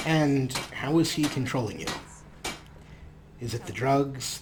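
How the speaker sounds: usual speaking voice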